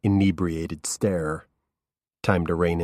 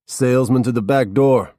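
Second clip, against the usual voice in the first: higher, louder voice for the stoner host; a lazy, mocking brush-off at the door; Norman's voice; 6 semitones higher, 7 dB louder than usual. A narrator's reading. Host Norman turning away the detective at the door, mocking.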